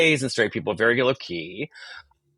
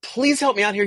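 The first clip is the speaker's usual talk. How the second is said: high-pitched pleading, retold; flustered plea; 10 semitones higher than usual. Starstruck guest asking the actor for help, flustered. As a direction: plead as if overwhelmed, pitched right up.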